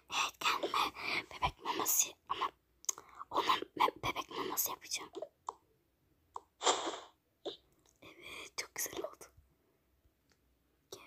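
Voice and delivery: secretive whispered aside. Acted. Whispering her secret plan to the viewers. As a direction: breathe it out like a sneaky plan. Secretly plotting the baby food trick.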